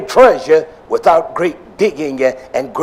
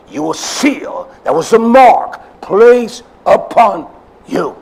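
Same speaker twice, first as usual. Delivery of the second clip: normal talking voice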